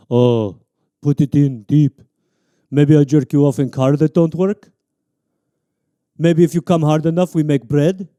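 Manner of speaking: heavy mock Russian accent. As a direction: ham up a Russian lover.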